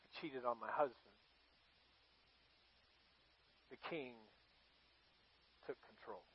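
low, hushed, halting confession; quiet, heavy-hearted murmur to oneself; ashamed inner voice. Acted. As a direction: voice her shame very softly, with long pauses. Voicing Bathsheba's walk of shame.

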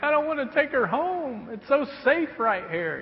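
high, pleading voice, lightly acted; tearful plea, retold. New father telling his tired wife why he's crying, high and pleading. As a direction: plead like a new dad who won't leave. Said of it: "I'm like".

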